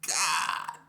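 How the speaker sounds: high, loud pained exclamation; pained groan word. Retold or imitated. Narrator hit on the spine, mock pained cry. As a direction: back hurt by the doorknob. Slammed into doorknob.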